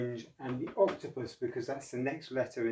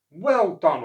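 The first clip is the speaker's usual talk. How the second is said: louder, warm praise; praising; 6 semitones higher, 12 dB louder than usual. Acted. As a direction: congratulate Oscar heartily. Mister O praises.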